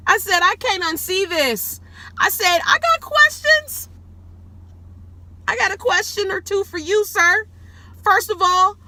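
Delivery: high-pitched, shouted mock interrogation; retold outraged questioning; loud indignant confrontation. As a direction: demand answers at the top of your voice. Confronting the man in retold quote.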